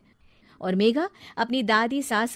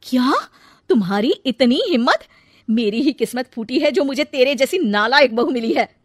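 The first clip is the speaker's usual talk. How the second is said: outraged; 4 semitones higher, 8 dB louder than usual. Acted. Mother-in-law outraged at bride.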